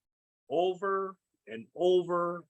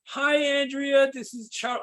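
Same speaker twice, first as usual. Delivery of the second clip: excited fun voice; 6 semitones higher, 4 dB louder than usual. Shown as a demonstration. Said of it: "I put on my, like, super excited, fun voice".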